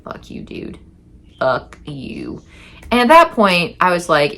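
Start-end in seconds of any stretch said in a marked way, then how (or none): 0.0-2.4 low-volume retold insult; muted defiance. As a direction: drop to a near-whispered curse. Retold muttered insult at Bob.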